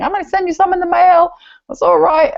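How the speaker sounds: a burst of excited mimicry; excited quote. Host voices Bonnie's reply.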